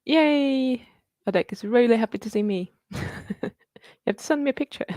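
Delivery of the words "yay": bright high little cheer, pitched up; excited cheer. Real, not acted. Greeting a happy viewer, excited high cheer. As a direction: squeal a happy yay.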